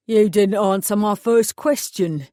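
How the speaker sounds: a burly East End mechanic taking over; East End accent. Acted. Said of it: "the man grunted".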